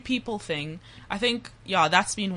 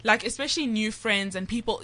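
ordinary talk, nothing marked

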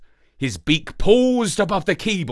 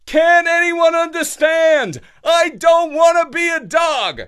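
high howling wail, much louder; a dog's anguished howl; 8 dB louder than usual. A narrator's reading. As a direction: howl it to the sky in despair. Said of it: "he threw his head up into the air and howled".

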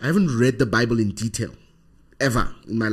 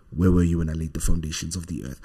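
low, reverent voice of God; deep God voice; 6 semitones lower, faster than usual. Retold or imitated. God speaking out of the clouds, deep and reverent. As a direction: intone it deep and solemn, as if from above. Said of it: "deep, deep voice with reverence".

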